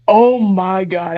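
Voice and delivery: ordinary delivery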